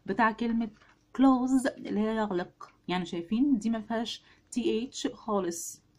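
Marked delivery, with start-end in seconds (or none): 1.2-1.7 "كلوز" as one syllable with a z ending; same as "close". Shown as a demonstration. Teacher compares it to the word for shutting, "كلوز" pronounced like close. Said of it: "نفس النطق تقريبًا".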